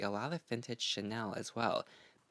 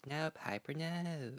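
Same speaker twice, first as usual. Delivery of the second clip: softer, drawn-out Southern drawl; Parker Posey; 5 semitones higher, 5 dB quieter, slower than usual. Acted. Vlogger savouring the actress's line, softer Southern drawl. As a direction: repeat the catchphrase quietly in a Southern accent. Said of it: "she really picked up her accent".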